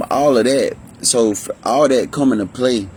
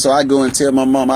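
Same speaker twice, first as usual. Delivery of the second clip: ordinary talk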